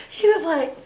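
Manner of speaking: ordinary talk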